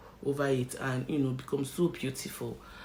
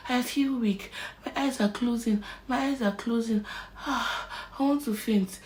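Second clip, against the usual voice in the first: faint plea; 7 semitones higher than usual. Retold or imitated. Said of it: "I was already saying".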